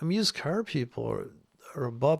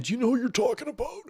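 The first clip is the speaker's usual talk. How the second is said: higher, fast whiny fan voice; mock outraged fan; 9 semitones higher, faster than usual. Mimicking fans defending the guru, high fast whine. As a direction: squeal it like an indignant follower.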